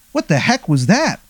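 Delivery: high, bewildered "what the heck"; startled question. Acted. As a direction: everyone in the bar caught off guard. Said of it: "and they go".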